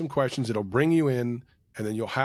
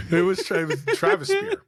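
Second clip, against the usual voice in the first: high laughing pun; 4 dB louder than usual. Real, not acted. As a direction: laugh through the joke.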